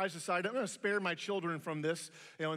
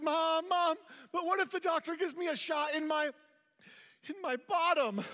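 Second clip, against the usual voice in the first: put-on high, pleading little-boy voice; childish crying plea; 9 semitones higher than usual. Scared boy begging his mom, high and pleading. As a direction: beg like a scared kid near tears. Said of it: "And so I started crying".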